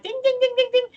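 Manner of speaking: high sing-song bell dings; ding ding alarm. Retold or imitated. To a friend on stream, describing gut instinct, as high playful bell dings. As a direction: ring it out like a little warning bell.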